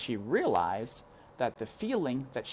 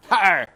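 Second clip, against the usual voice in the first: loud scornful har; loud jeering laugh; mocking laugh; 8 semitones higher, 14 dB louder than usual. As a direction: bark out a laugh. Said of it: "laughed Uncle".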